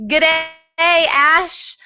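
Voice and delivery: high, bright-eyed mock Australian "G'day"; a playful stab at an Australian accent. Greeting Ash at the start in a mock Australian accent. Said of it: "I know mine sounds really great".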